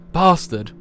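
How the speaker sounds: one-word outburst, punched loud and high; shouted curse. Acted. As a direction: hurl the insult out hard. Character curses an enemy.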